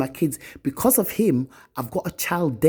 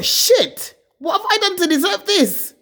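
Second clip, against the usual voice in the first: much higher, louder anguished cry; despairing thought; 4 dB louder than usual. Retold or imitated. Recalling her despair in court, high anguished cry. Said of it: "I thought".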